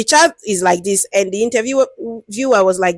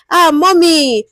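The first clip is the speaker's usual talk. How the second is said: a chatty, friendly hello to an elder; lightly voiced friendly researcher; 4 semitones higher, slower than usual. Shown as a demonstration. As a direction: ease in gently like greeting a street cleaner you respect. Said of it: "just start on a very light note with them".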